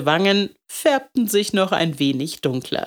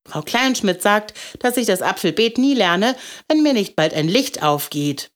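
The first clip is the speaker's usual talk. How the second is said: put-on higher child's voice; troubled little boy; 5 semitones higher than usual. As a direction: report the teacher's verdict like an upset kid.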